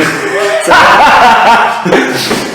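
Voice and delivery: loud, high laugh; burst of laughter. Real, not acted. Friends laugh at Twilight as the example, loud laughter. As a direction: crack up out loud.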